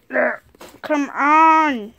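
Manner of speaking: loud exasperated come on; fed-up outburst; frustrated shout. Real, not acted. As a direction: yell it at the sliding cards. Frustrated with sliding cards.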